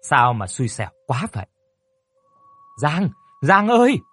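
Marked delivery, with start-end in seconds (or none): 2.8-4.0 high, loud sudden call of a name; urgent call. Acted. Mother bursting in calling her injured daughter, loud urgent call. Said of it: "Tiếng gọi giật giọng của mẹ".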